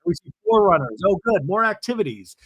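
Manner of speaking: ordinary delivery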